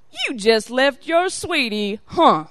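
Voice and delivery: put-on slow, drawn-out Southern accent. Security agent guessing why she cries, Southern accent. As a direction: imitate a kindly Southern-accented officer. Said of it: "with that collard green accent".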